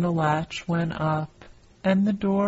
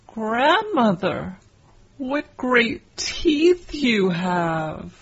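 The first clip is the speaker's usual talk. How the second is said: higher, slower, louder and alarmed; rising fear, drawn out; growing alarm; 6 semitones higher, 3 dB louder, slower than usual. Acted. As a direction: ask it slowly, frightened by the teeth. Girl's last, frightened question.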